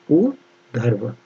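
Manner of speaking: very slow उधर्व, oo... dharv; low, drawn-out spelling pace; syllable-by-syllable dictation. Shown as a demonstration. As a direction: dictate उधर्व, holding the first vowel apart. Teacher dictating spelling slowly.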